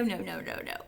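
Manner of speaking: ordinary delivery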